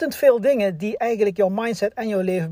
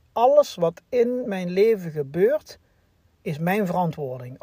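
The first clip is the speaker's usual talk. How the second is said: slow, weighty statement, fading out; slow solemn motto. Shown as a demonstration. Leaving the listeners with a slow, weighty motto. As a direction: lay down the motto slowly. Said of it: "laat jullie achter met die stelling".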